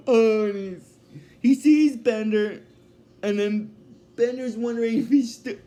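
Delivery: ordinary talk, nothing marked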